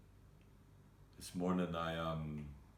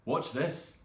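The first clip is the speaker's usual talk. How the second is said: higher, louder retold defiant outburst; retold defiance; 5 semitones higher, 6 dB louder, slower than usual. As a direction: throw it out as a slow, loud challenge. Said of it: "flipped a switch in me where I was just like".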